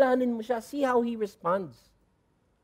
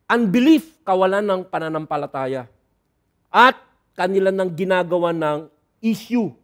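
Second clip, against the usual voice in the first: normal talking voice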